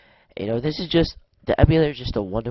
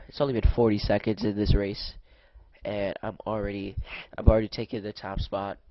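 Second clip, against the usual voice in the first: ordinary talk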